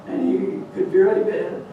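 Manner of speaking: ordinary delivery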